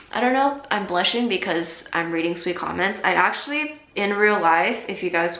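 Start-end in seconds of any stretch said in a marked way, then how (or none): none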